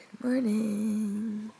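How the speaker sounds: slow, sleepy groggy voice; just-woken groggy drawl. Real, not acted. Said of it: "my voice is very groggly".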